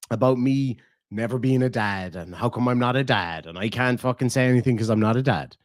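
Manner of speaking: usual speaking voice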